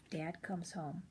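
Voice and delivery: usual speaking voice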